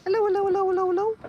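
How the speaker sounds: very high, squeaky little dog greeting; voicing the dog. Owner speaking for her dog, squeaky high dog voice. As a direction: chirp hello up high for the dog. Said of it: "say hello".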